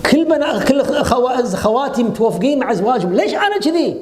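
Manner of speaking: high, quick, aggrieved whine at fate; complaining woman. Retold or imitated. Preacher imitates a woman protesting against God's decree, high and whiny. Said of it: "احتجاج على قدر الله".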